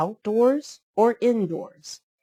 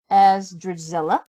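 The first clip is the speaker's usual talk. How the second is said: usual speaking voice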